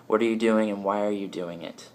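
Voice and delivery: ordinary talk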